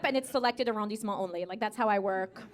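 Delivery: ordinary talk, nothing marked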